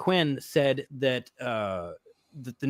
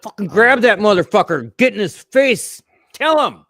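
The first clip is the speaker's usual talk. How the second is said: loud, higher, worked-up urging; 7 semitones higher, 9 dB louder than usual. Real, not acted. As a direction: push it out loud and fired up.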